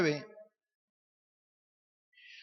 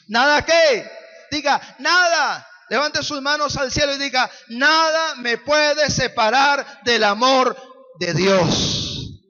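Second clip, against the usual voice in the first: loud call for response; preacher's call; 3 semitones higher, 8 dB louder than usual. Acted. Preacher leading the congregation in a loud chant. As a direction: lead the church to shout it back.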